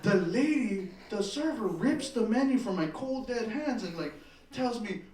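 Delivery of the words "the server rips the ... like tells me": normal talking voice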